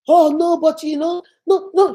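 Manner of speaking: high, flustered mock protest; flustered cook imitated. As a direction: imitate someone backpedalling to the boss. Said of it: "She told the guy".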